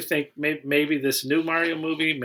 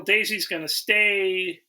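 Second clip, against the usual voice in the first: usual speaking voice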